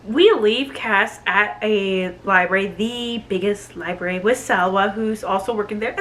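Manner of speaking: ordinary delivery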